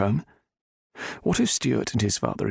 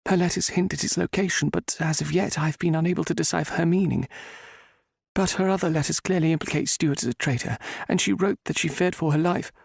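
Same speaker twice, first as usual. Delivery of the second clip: higher woman's voice, earnest; heroine; 9 semitones higher than usual. A narrator's reading. Heroine explaining the letters to the Viscount. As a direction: explain urgently in her voice.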